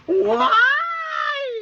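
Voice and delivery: sudden high squeal. Real, not acted. Baby on camera shrieking high.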